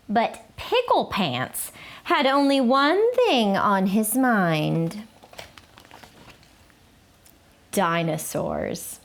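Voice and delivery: lively, expressive storybook read-aloud; reading to children. A narrator's reading. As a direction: read it like story time for little kids. Reads picture book to children.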